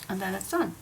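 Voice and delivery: normal talking voice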